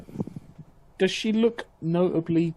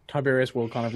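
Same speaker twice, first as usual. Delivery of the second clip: usual speaking voice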